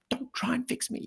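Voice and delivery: very high mock protest; hammed-up squeal of objection; exasperated protest. Wife objecting to husband's advice.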